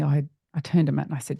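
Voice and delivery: ordinary delivery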